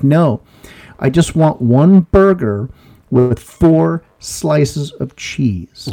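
patient but pressed, each word laid down; slow insistent order. Retold or imitated. Customer repeating his burger order to the attendant, slow and firm. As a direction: spell the order out slowly, holding back.

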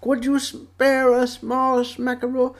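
high, soft, slow cartoon bear; imitated Winnie the Pooh. Bear begs gopher for honey, soft high imitation. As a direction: plead it gently, slowly, up high. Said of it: "Pooh goes".

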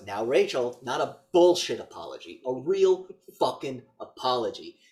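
loud, measured villain scolding; acted-out menacing insistence; mock stern demand.

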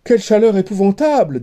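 fast, high exclamation; blustering suitor. A narrator's reading. Younger brother to the princess, fast excited complaint. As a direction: burst out about the heat.

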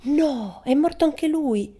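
high, slow, loud mock wail; hammed-up grief over a dead king; mock lament. A narrator's reading. Pippi lamenting the dead king, hammed-up mock grief. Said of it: "si lamentò Pippi".

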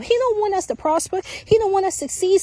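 usual speaking voice

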